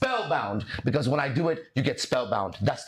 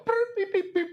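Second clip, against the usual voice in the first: high droid beeping; robot beeps. Retold or imitated. Mocking a droid, chirping its beeps. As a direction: beep like R2D2.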